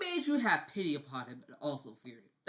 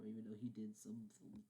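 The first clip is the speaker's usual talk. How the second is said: hushed aside; soft whisper; 6 semitones lower, 15 dB quieter than usual. Real, not acted. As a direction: whisper it under your breath.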